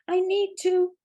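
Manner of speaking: high, loud plea; pleading call. A narrator's reading. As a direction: beg her to stop, pitched high and loud. Lewin pleading after her.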